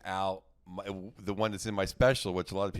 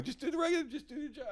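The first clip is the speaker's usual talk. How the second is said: pleading fans; 4 dB quieter, faster than usual. Retold or imitated.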